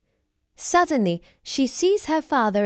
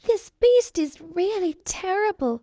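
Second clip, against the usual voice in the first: terrified; 8 semitones higher than usual. A narrator's reading. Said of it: "she is terrified".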